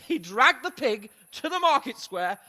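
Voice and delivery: ordinary talk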